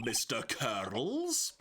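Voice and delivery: put-on slow, drawn-out Scottish accent; accidental Scottish lilt, very slow; slipping into a Scottish accent. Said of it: "I don't know why I went Scottish then".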